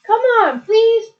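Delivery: high, loud pleading plea; loud begging. Real, not acted. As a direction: beg him loudly to give in. Begging the machine to shower.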